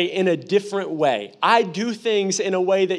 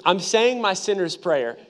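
ordinary delivery

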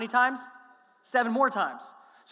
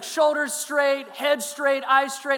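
louder, higher, slow barked orders; drill sergeant commands; 5 semitones higher, 5 dB louder, much slower than usual. Retold or imitated. Drill sergeant ordering the recruit to attention, bark. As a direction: snap each command out. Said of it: "treat me like you're a drill sergeant".

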